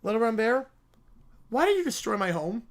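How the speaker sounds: usual speaking voice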